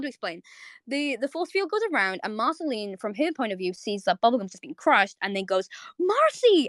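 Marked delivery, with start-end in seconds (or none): 6.0-6.7 high mock scream of "Marcy"; a hammed-up cry, much higher; retold scream. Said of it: "and screams".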